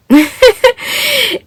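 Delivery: high-pitched giggle; real giggle. Real, not acted. Speaker laughing mid-sentence about her offering, high giggle. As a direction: break into a high little laugh.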